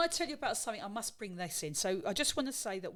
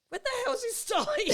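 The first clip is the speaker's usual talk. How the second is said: much higher, louder mock outburst of impatience; exasperated shout; 4 dB louder than usual. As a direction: yell it in disbelief at the wait. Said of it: "I said".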